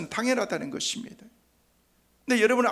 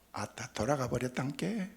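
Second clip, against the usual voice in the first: put-on lightly done Jeolla accent, mildly exasperated; a casual hint of Jeolla dialect on 아따; 6 semitones lower, 8 dB quieter than usual. Preacher imitating how Jeolla people announce a death, light Jeolla accent. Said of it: "전라도 사람들은 그런데요".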